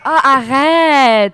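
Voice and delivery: exasperated Parisian arrête. Retold or imitated. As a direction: stretch the ending of arrête like a Parisian. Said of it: "I'm always like".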